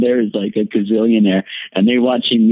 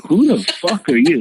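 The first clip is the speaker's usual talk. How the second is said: pitched up high, half-laughing disbelief; retold startled outburst; 8 semitones higher than usual. Waking to strangers, mock shocked high yelp. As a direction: replay the shock of strangers at your bedside. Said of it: "And I'm like".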